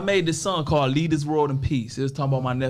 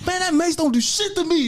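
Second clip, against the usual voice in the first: cocky brush-off; 13 semitones higher, 3 dB louder than usual. Retold or imitated. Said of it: "I said".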